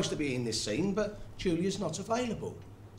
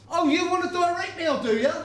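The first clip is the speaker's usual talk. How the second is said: sarcastic anger; 11 semitones higher, 5 dB louder than usual. Real, not acted. Director snaps at actor.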